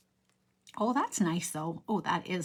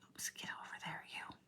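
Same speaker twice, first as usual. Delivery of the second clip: whispered oops to the paper; 17 dB quieter than usual. Real, not acted. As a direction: scold the paper in a hush. Nudging paper.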